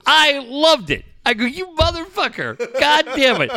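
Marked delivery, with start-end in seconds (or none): 1.4-3.5 much higher, laughing curse; laughing outburst. Retold or imitated. Reacting to the Semi-Pro video, a laughing curse.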